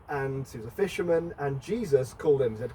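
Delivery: ordinary talk, nothing marked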